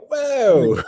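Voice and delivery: high, loud awestruck whoa; a gasp of wonder, hammed up; awed whoa. Imitating awestruck classmates, with a high hammed-up whoa. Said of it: "that awe".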